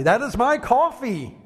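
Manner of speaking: high-pitched imitation of an irate scream; mock screaming. Coffee shop customer at someone touching his drink, mock screaming. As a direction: screech it like an outraged stranger. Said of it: "this man started screaming at me".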